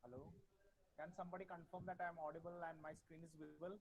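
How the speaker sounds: very faint, far-off voice; barely audible. Real, not acted. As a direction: speak barely above a murmur. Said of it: "Sir, your voice is very low".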